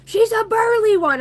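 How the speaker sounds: very high, loud mimicry; mocking jeer.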